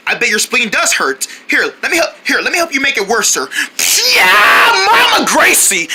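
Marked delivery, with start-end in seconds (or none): 4.1-5.9 high, loud triumphant whoop; battle cry. Pretend. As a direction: cheer as you strike.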